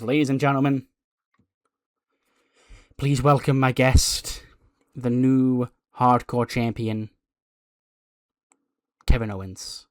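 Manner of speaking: understated ring introduction with little excitement; unexcited introduction. Acted. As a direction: introduce the champion without enthusiasm, in measured phrases. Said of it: "could have been a little bit more excited".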